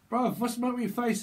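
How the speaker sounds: acted taken-aback friend; appalled question. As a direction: ask in exaggerated disbelief. Acted shock at friend's face.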